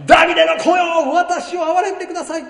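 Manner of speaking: a blind man's loud high plea for mercy; high crying out. Retold or imitated. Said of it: "と叫び立てた".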